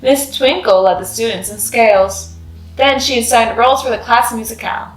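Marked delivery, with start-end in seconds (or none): none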